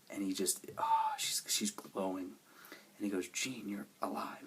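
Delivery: awed, hushed whisper. Real, not acted. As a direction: breathe it out in wonder. Awed retelling of seeing Jean.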